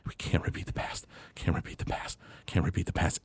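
whispered, repeating under the breath; barely voiced murmur, over and over; dazed muttering. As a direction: mutter it to himself, lost in shock. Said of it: "he just starts muttering".

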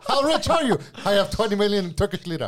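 mock Turkish accent. Pretend Turkish rich man.

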